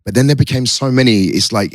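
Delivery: ordinary talk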